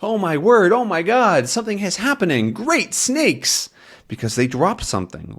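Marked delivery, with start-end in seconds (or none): 0.0-3.7 high, excited exclamations; astonished. Acted. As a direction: act out mounting amazement, pitched high. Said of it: "is just what Tintin says as".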